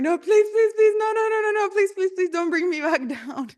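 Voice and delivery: very high, hammed-up begging; mock pleading. Dad begging to stay in nirvana, high mock pleading. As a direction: squeal out repeated pleas, half laughing at the end. Dad begs not to come down.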